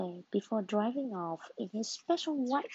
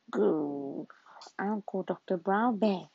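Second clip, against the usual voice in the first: daddy voice; 4 semitones lower than usual. Acted. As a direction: lower father voice. Father voiced in story.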